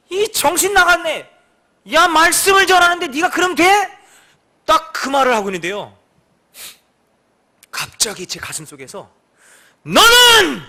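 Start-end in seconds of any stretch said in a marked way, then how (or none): 0.1-3.6 loud, high rebuke; scolding a friend. Retold or imitated. Pastor rebuking his friend who refuses to carry goods, loudly. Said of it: "제가 그 친구한테". 9.8-10.6 sudden loud, high cry; a divine voice calling out sharply; inner voice shouting. Retold or imitated. Voice from within the heart calling out, shouting. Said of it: "그러는 거예요".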